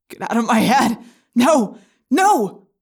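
loud rising shout of panic; screaming. Acted. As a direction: scream him out of your head. Jake screams at the Yeerk.

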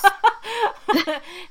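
high bursting laugh. Real, not acted. Host laughing at the co-host's joke, high and bright.

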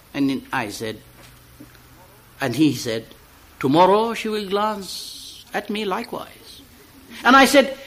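recited dialogue between two friends; poem reading. Acted. Reader performs a poem of two friends, recital style. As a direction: read it as a staged exchange.

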